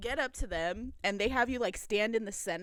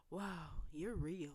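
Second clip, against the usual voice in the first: slow, hushed awe; 4 semitones lower, 10 dB quieter, slower than usual. Retold or imitated. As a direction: breathe it slowly, starstruck and softer. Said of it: "like, in awe".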